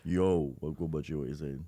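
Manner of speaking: low London street voice; london roadman accent. Pretend. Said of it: "let's have it again".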